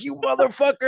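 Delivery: high, loud imitation of a furious attacker; angry yell. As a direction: yell it like the man chasing you. Attacker chasing, shouting at him.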